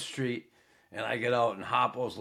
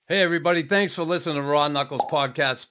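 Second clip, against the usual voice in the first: loud, bright sign-off, much higher and louder; podcast outro; 5 semitones higher, 5 dB louder than usual. Acted. Host thanking listeners to like and subscribe, loud upbeat sign-off. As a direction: sell it like a host wrapping the show.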